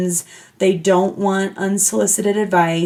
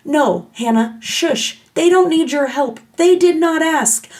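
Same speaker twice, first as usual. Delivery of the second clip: self-scolding; 7 semitones higher than usual. Retold or imitated. Scolding herself in inner voice.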